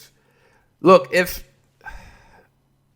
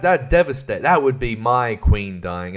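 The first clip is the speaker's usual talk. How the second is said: ordinary talk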